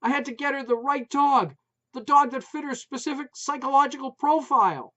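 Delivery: high, loud shout. A narrator's reading. Wife shouting news about the new dog, loud. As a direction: yell it over the dogs. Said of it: "Maureen shouted again".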